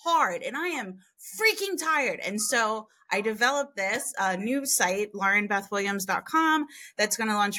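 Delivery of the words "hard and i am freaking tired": high, worn-out mock complaint; weary exasperation. Parents finally saying it aloud, exasperated and worn out. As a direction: let the exhaustion spill out in a lifted voice. Voicing what parents want to say.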